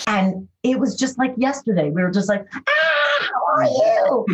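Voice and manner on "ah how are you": excited reunion greeting. Retold or imitated. Recalling seeing old castmates again, an excited high shout of greeting. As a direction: greet old friends like it was yesterday.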